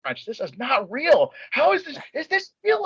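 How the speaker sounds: rising disbelief. Retold or imitated.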